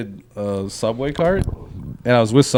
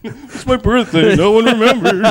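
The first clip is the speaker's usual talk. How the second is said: hammed-up whining through laughter; mock crying; 9 semitones higher than usual. Playing a crying nineteen-year-old scolded by dad, mock tearful wail.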